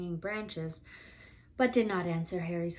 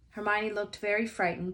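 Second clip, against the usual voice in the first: usual speaking voice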